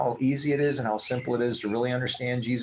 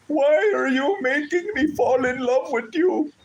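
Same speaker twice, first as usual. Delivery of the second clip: much higher, louder, slow weepy imitation; imitating tearful caller; 5 dB louder than usual. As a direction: play the customer breaking down, high and drawn out. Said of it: "he goes".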